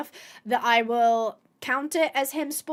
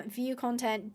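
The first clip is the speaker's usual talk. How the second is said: ordinary talk, nothing marked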